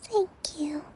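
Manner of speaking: sinking into sleep mid-thanks; a soft whisper as she nods off; sleepy whisper. Acted. Drifting off to sleep.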